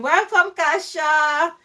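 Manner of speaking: excited. Real, not acted. Welcoming friend on the live.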